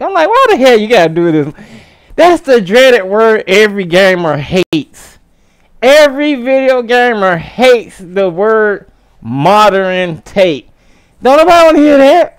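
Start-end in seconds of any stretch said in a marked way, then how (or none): 0.3-1.5 exasperated outburst. Retold or imitated. Quoting his reaction to remake. 11.2-12.4 high, loud and fast rant; exasperated shout. Real, not acted. Ranting about modern take.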